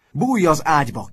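loud, high yell across the room; boy yelling at a roommate; shouted order. A narrator's reading. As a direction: shout it as a bossy command. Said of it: "kiáltott az egyik fiú".

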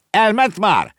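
put-on high, loud little-girl voice; anxious child's question, pitched right up; little girl asking. Little girl up a tree to the boy, asking high and loud. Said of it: "kérdezte a kislány".

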